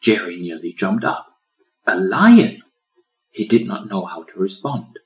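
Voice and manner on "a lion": sudden louder cry of alarm; startled. A narrator's reading. Turtle seeing a lion, startled gasp. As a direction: jump at the sight.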